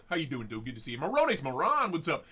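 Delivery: normal talking voice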